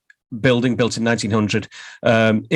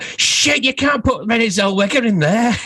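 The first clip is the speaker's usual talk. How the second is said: much higher, squeaky mock horror; shocked outburst; 12 semitones higher than usual. Vice chairman horrified they put the star in the toilets, high mock outburst. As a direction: yelp it out in mock alarm.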